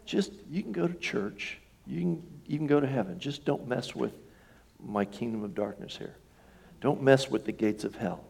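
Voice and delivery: lightly voiced devil, soft and coaxing; voicing the devil. Preacher voicing the devil, soft and coaxing. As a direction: quietly coax like the devil bargaining.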